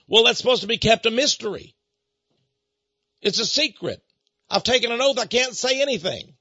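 imitated indignant protest; angry caller. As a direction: act out a riled caller refusing to answer. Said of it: "Boy, he was angry".

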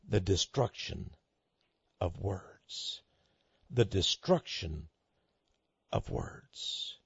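low, grave near-whisper; hushed. Acted. Preacher quoting the bureaucrat on destroying words, low hush. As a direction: let it sink down to a hush at the end. Hushed repeat of quoted phrase.

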